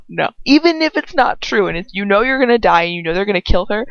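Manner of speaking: ordinary delivery, nothing marked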